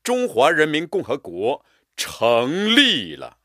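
成立了 rung out loud and slow; proud stressed proclamation. Shown as a demonstration. Teacher proclaiming, stress on 成立了. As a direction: announce it, leaning on 成立了. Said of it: "也是加强音强和力度".

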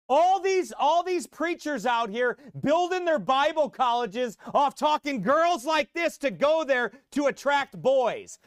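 loud, high preaching at full force; fiery preaching. Real, not acted. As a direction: hammer it from the pulpit.

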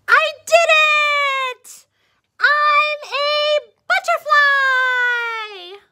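high, loud sung-out joy; triumphant cry. Acted. New butterfly cries out in joy at emerging. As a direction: burst out singing with triumph.

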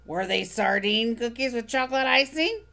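gleefully sour, savor every complaint; Oscar grouch. Acted. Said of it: "Oscar asked".

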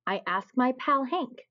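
ordinary delivery, nothing marked

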